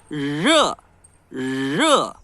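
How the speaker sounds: Rê mais alto, queixo para frente, vogal nasal. Shown as a demonstration. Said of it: "pôr o queixinho da gente pra frente". (rê nasal retroflexo)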